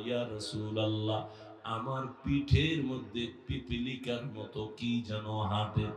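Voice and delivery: very low, hushed confiding voice; retold weakened weeper. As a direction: imitate a spent penitent, barely voiced. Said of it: "কান্নার এক পর্যায়ে সালামা কয়". (ক্ষীণ, নিচু স্বরে বলা)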